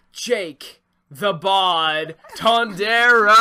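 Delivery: slow loud ring intro; announcer voice. Pretend. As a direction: boom out the name.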